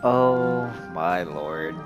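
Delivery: ordinary talk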